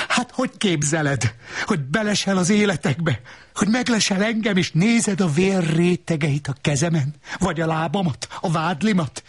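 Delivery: high, rapid-fire tirade; worked-up quarrelling voice; angry accusing. A narrator's reading. Angry accusations about peeping.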